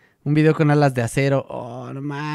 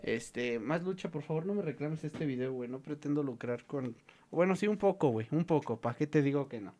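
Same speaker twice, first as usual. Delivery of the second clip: quiet aside; 10 dB quieter than usual. Real, not acted. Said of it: "pleads to rights holder quietly".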